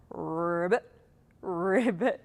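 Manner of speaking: low, soft croaked ribbit; frog ribbit. Shown as a demonstration. Teacher answers children about the frog's sound, low frog ribbit. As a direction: imitate a frog, pitched low. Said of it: "What about a frog?".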